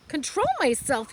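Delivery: put-on a girl yelling back at her father; indignant yell. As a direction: throw his words back at him. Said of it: "yelled Fern".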